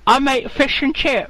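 fake Chinese accent. Pretend Chinese farmer gives his name, fake Chinese accent. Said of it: "I can't do the accent".

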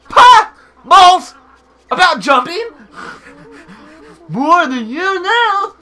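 high, loud, peppy cartoon voice; peppy character. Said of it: "make him a little bit more peppy".

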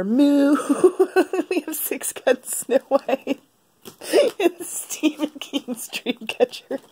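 high, slow words squeezed through real giggles; busy laughing. Real, not acted. Vlogger listing books after a silly title, laughing helplessly. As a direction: try to list the books while cracking up. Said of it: "I'm so busy laughing".

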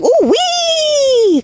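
high, delighted mock whoop; gleeful whoop. Watching the gorilla drag the boy, a high gleeful whoop. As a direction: whoop like it's a fun ride. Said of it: "it kinda looked kinda fun to me 'cause I was like".

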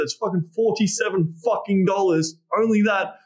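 ordinary talk, nothing marked

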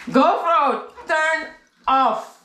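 high, loud, word-by-word command. Real, not acted. Told to soften it, still calls loudly to the GoPro. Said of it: "Softer voice".

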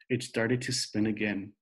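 ordinary delivery, nothing marked

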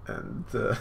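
ordinary talk

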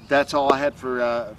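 ordinary delivery

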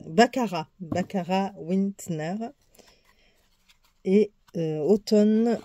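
English names in a French accent; French-accented, halting attempt at English names; real French accent. Real, not acted. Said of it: "excusez vraiment mon accent, ma prononciation".

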